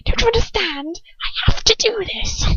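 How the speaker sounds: high, whiny hammed-up Draco; mock whiny Draco. Mocking Draco's angst, hammed-up whine. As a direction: whine it melodramatically. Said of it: "He's just like".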